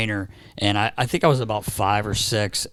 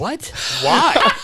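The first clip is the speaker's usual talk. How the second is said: put-on high, alarmed child's cry of disbelief; a five-year-old suddenly frightened, much higher and louder; scared kid; 11 semitones higher, 5 dB louder than usual. Kid hearing about machine guns.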